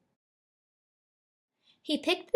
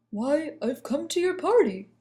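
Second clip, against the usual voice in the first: lightly voiced surprised lion; a hint of a confused lion; 4 semitones higher than usual. A narrator's reading. Dandelion answers.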